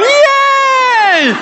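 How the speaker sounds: "Yay!" as a high, loud, gleeful whoop; audience cheer. Retold or imitated. Speaker voicing the proud right-thumb crowd, loud whoop. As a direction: cheer it like the winners just got praised. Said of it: "we've got people going".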